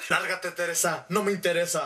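low, dismissive man's voice; Fernando. Retold or imitated. Fernando sends Teresa away, low and cold. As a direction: brush her off in a put-on low voice. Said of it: "a devolverle el dinero a Fernando".

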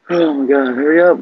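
usual speaking voice

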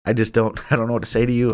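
normal talking voice

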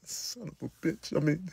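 ordinary talk

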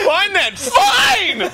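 high protest rising to a yell; mock tantrum. Friend playing the sulking son, mock huffy yell.

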